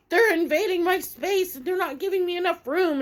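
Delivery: high, loud put-on protest; shrill-pitched mocking tantrum; mock whining complainer. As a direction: imitate a worked-up man complaining. Man whines back, retold.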